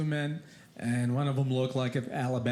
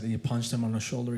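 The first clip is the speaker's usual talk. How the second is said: ordinary talk, nothing marked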